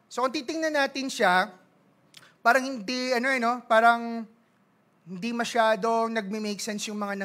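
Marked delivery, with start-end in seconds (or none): none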